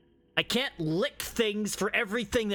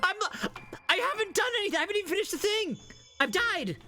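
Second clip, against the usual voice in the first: pitched way up in flustered protest; squeaky disbelief; 13 semitones higher than usual. Real, not acted. To donors, a flustered high squeal. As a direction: squeal it like you can't believe it. Overwhelmed by donations.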